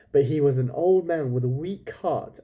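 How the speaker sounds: ordinary delivery, nothing marked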